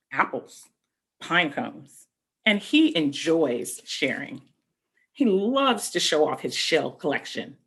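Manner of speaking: put-on slow, gentle read-aloud to children; storybook reading. A narrator's reading. Teacher reading a picture book to young children, slow gentle storybook narration.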